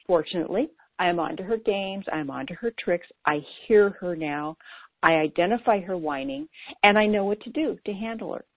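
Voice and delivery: normal talking voice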